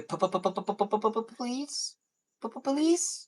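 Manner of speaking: very high stuttered whine; squeaky desperate stammering plea; begging please. Retold or imitated. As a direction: beg pitifully, pitch climbing. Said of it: "And I'd be a".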